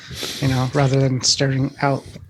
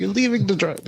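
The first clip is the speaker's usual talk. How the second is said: mock yell; 6 semitones higher than usual. Rider tracking the driver yells at the phone, mock yell. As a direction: playfully yell it in a raised pitch. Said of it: "you start yelling at the phone".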